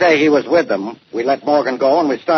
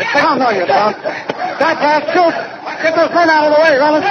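shouting orders; 13 semitones higher than usual. Acted. Inspector shouting at men to clear the way. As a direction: yell to clear the way. Chasing fleeing suspect, calling men.

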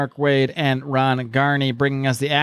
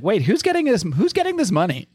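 curious question; 8 semitones higher than usual. Retold or imitated.

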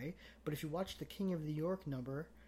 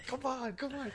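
put-on bossy kid yelling; 10 semitones higher than usual. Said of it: "He's yelling at the papers".

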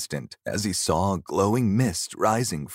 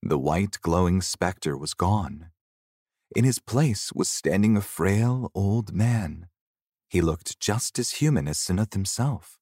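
ordinary delivery

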